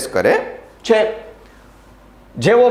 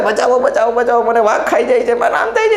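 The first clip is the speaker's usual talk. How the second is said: hammed-up shrieks for help, much higher, laughing; mock panicked shouting; 10 semitones higher, faster than usual. Teacher mocking the coward shouting that the tiger eats him, high laughing mock panic. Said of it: "રાયડો પાડવા માંડ્યો".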